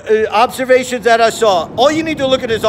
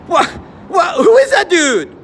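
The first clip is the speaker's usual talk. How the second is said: shocked question; 7 semitones higher than usual. Real, not acted. Shocked reaction to the video.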